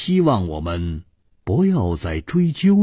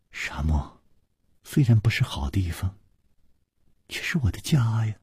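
soft, wistful near-whisper; choking up; 3 semitones higher, 6 dB quieter than usual. A narrator's reading. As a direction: speak softly, close to choking up. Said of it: "忽然哽咽起来". (轻声感伤，将要哽咽)

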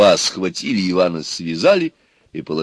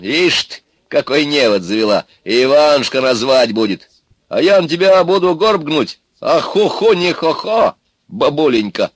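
loud, higher-pitched indignant jeering; mocking indignation; 7 semitones higher, 6 dB louder than usual. Ivan refuses to slave for Baba Yaga, mocking. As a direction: jeer at the old woman's scheme.

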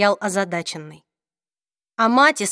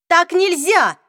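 desperate outcry, higher and louder; desperate cry; 7 semitones higher, 4 dB louder, slower than usual. A narrator's reading. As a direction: cry out in despair. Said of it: "отчаянно воскликнула Клэри".

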